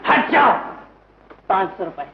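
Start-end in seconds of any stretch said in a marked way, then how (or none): 0.1-0.5 loud, high burst of anger; angry shout. Acted. Brother demanding money to sister-in-law, angry shout. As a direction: order them out of the way, loudly. Angry man shoves past.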